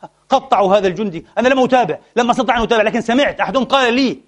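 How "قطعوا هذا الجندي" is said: ordinary talk, nothing marked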